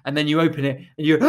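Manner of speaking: ordinary talk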